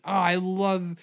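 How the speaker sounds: ordinary delivery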